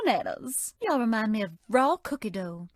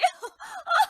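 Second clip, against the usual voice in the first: high, louder giggling squeal; high squealing laugh; 5 dB louder than usual. Acted. Tickled again.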